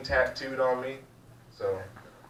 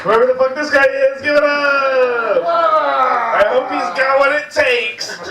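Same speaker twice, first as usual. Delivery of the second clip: high, loud announcer yell; hype shout; 10 semitones higher, 8 dB louder, slower than usual. Real, not acted. Shouting for the crowd to applaud the next act, hyped yell. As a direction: yell it to whip up the room, stretching the last words.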